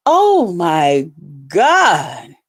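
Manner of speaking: very slow, low oh my God; disgusted groan. Real, not acted. About the rival's naked photos, slow disgust. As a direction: drag it out in disgust.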